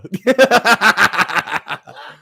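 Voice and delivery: high, loud burst of laughter; real laugh. Real, not acted. Laughing at a viewer's piss filter joke, big high laugh. Reacting to chat's joke comment.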